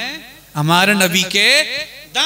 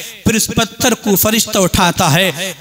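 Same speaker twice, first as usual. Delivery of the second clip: ordinary talk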